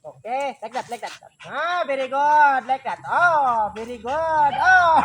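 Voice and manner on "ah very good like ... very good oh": drawn-out praise. Real, not acted. Praising the pet.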